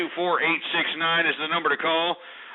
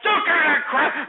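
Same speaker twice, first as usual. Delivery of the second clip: Don't give me that crap, high and loud; host scolding the prank caller; angry retort; 10 semitones higher, 4 dB louder than usual. Real, not acted. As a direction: snap back at the caller. Rejecting caller's remark.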